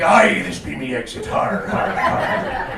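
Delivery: comic pirate impression. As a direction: growl it like a storybook pirate. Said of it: "Do you think that voice is offensive to pirates?".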